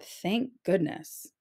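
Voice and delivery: usual speaking voice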